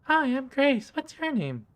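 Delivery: put-on high, sweet little girl doll voice; a doll's squeaky childlike voice; creepy talking doll. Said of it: "the mouth begins to move".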